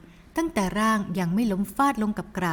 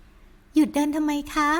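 put-on higher, slow girlish voice; sweet daughter; 6 semitones higher, much slower than usual. Daughter reassures her mother, higher and slow. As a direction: coax mother gently, high.

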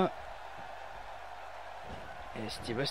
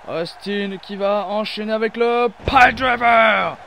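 loud excited commentator call; excited shout; 2 semitones higher, 7 dB louder than usual. Real, not acted. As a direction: belt it out for the big move. Big move, commentator shouts.

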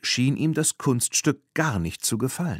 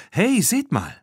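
higher-pitched excited call; 12 semitones higher than usual. A narrator's reading. Bibi alerting her friends to a rider, calling out. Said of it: "rief Bibi".

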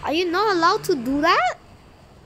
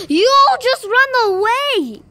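exasperated call-out; 6 semitones higher, 7 dB louder than usual. Real, not acted. Complaining about fleeing kaijus.

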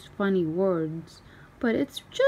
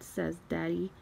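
plain reading voice

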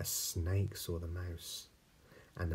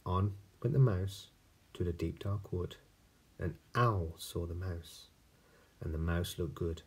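ordinary delivery, nothing marked

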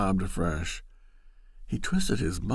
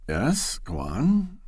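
urging; 6 semitones higher, slower than usual. Acted. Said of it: "he urged".

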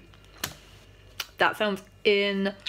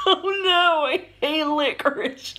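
speaking through laughter; amused groaning laugh; laughing while talking; 8 semitones higher, 4 dB louder than usual. Real, not acted. Seen 0: Broad laughing smile, hand on forehead; no visible chewing or food.

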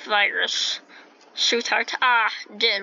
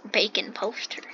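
whisper; 3 dB quieter than usual. Real, not acted. Reads sign in whisper.

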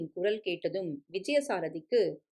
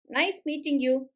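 polite husband; 7 semitones higher than usual. A narrator's reading. Husband's polite English greeting.

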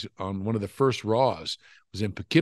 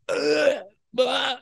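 gagging ugh. Retold or imitated. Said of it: "I'm like".

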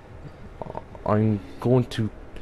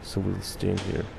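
ordinary talk

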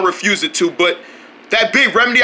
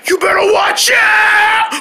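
slow, higher shouted warning; stretched-out cartoon warning yell; 10 semitones higher, slower than usual. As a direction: call it out big so they hear. Character warns loudly.

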